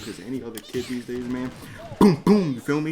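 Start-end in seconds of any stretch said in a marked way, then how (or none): 2.0-2.5 loud, high booming punch noises; punch sound effects. Retold or imitated. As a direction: smack it out like blows landing. Imagined hitting, sound effect.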